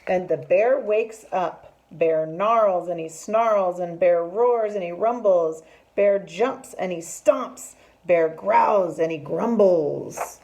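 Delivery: loud, slow, rhythmic storytelling; dramatic story reading. A narrator's reading. Teacher reads bear waking up cross, loud dramatic narration. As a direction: hit each growling verb hard for the children.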